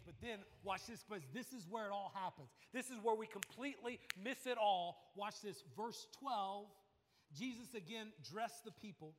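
fiery preacher proclaiming; preaching at full force. Acted. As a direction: proclaim it like a bombshell. Sermon climax, full force.

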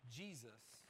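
normal talking voice

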